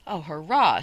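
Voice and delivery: loud, delighted hurrah; cheering. A narrator's reading. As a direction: burst out with a cheer. Boy cheers going north.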